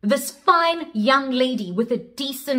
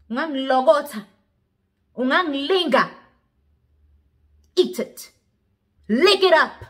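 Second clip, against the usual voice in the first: menacing order; 5 semitones higher, 3 dB louder than usual. Acted. Old pervert orders woman to eat.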